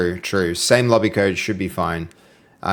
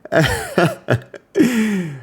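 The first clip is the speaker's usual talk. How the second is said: laugh; 9 semitones higher than usual. Real, not acted. Laughing at own joke.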